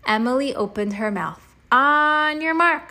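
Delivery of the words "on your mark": slow, high, loud race call; shouted countdown. Acted. Boy starting the swing launch, slow and loud. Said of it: "I shouted".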